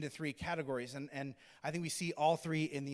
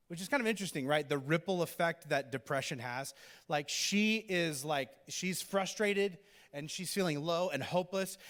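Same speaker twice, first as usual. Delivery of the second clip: normal talking voice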